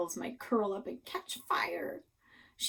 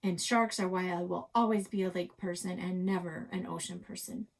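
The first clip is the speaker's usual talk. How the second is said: ordinary delivery, nothing marked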